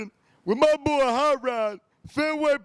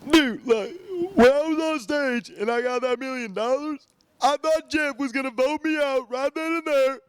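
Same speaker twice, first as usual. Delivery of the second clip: imitating Mike; 4 semitones higher than usual. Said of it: "Can you do an impersonation of Mike winning".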